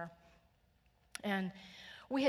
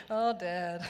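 laughing oh Dad, a bit louder; fond laugh; 6 dB louder than usual. Real, not acted. Laughing at Dad's old president joke, fondly.

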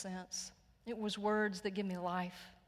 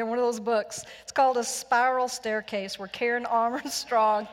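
louder, laughing through the words; 3 semitones higher, 11 dB louder than usual. Real, not acted. Preacher to church after a stumble, laughing loudly. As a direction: laugh your way through the title.

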